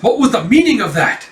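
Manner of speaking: high, indignant question; shocked demand. Retold or imitated. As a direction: imitate a stunned wife confronting someone. Shocked wife demands explanation.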